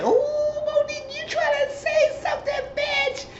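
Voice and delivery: very high, drawn-out teasing call-out; stretched falsetto, hamming up the shock; mock scandalized squeal. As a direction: squeal it slowly in playful accusation. Acted teasing call-out in retelling.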